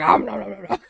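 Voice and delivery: greedy cartoon chomping; mock munching noises. Imitating shoveling movie popcorn, mock munching. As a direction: gobble the syllables playfully.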